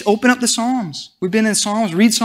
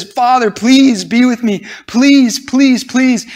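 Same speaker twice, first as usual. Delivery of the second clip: higher, louder begging, without actual sobs; imitated tearful pleading; 5 semitones higher, 4 dB louder, slower than usual. Preacher voicing Jesus weeping in the garden, imitated pleading. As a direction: plead urgently, each please leaned on. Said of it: "Jesus starts weeping and he says".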